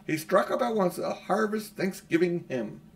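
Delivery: plain reading voice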